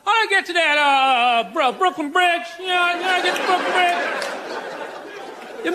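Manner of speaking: put-on harsh Southern accent. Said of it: "a really harsh Southern accent".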